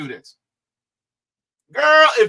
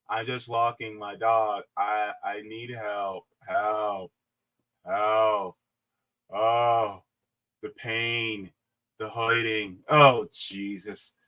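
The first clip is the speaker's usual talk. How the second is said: lower, softer, hammed-up moaning for help; mock pain; 8 semitones lower, 5 dB quieter, slower than usual. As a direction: overact her fake suffering. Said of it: "mocking her".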